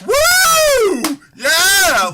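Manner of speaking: high, loud whooping cheer; mock cheering. Kid whooping at mom's roasted ham, hammed-up cheer. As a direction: whoop at mom's dinner like a fan. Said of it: "You're just like".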